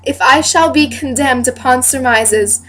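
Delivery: measured stage declamation rising in force; Hermione. Acted. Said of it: "I am reading as the character Hermione".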